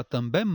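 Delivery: TAMBÉM closed on a sounded m, também-m; wrong pronounced M. Shown as a demonstration. Teacher asking if you say it so, TAMBÉM with hard m. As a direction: release a consonant m at the end of também. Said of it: "você fala também?".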